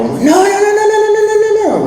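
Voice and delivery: high, rapid-fire string of panicked no's; panicked protest. Retold or imitated. Running out to the tow truck, panicked protest. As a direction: cry out to stop it, pitch leaping up.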